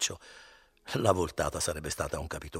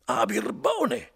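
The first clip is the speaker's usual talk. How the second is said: put-on much higher, louder, slow drunken cry; indignant exclamation; 8 dB louder, much slower than usual. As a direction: explode at the innkeeper's trick. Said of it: "esclamò Renzo".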